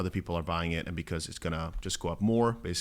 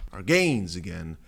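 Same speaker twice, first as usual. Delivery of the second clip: ordinary talk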